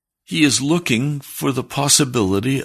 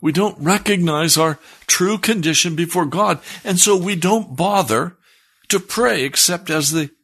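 higher, building sermon delivery, pressing each list word; impassioned preaching; 8 semitones higher than usual. Acted. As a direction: preach it with rising urgency to the congregation. Preaching builds to full force.

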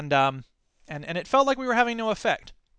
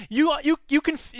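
ordinary talk, nothing marked

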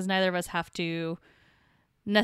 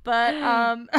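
high, laughing through words; barely holding back laughter; 7 semitones higher, 5 dB louder than usual. Real, not acted. Trying not to laugh.

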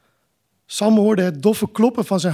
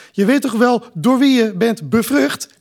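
raised, accusing question; a son pressing his mother, raised voice; loud; 4 semitones higher, 4 dB louder than usual. A narrator's reading. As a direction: push the accusation at them. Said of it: "riep Kai".

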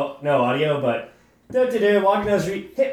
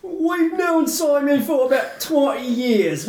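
higher, laughing mock British accent; hooligan accent; 5 semitones higher than usual. Quoting the bar manager, in a silly British accent. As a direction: keep the put-on hooligan accent going. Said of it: "rate his accent".

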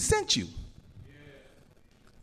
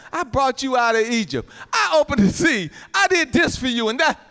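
raised, emphatic imitation of a scolding reminder; rambunctious; 6 semitones higher than usual. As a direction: throw it back at them, high-pitched and forceful. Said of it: "when I really get, get uh, rambunctious".

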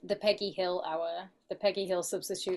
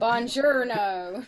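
mock tourist greeting; 3 semitones higher, 4 dB louder, much slower than usual. Co-host joins the tourist bit.

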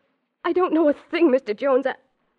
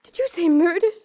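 gasp, then a stunned, higher murdered; horrified disbelief at the news; gasping in shock at news of a murder; 4 semitones higher, 3 dB louder than usual. Acted. Mary hears of the murder.

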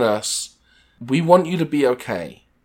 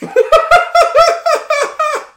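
loud high laugh; 5 dB louder than usual. Real, not acted. Laughing at the review.

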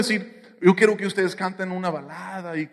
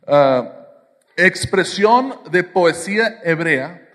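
usual speaking voice

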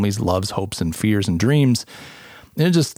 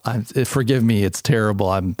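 normal talking voice

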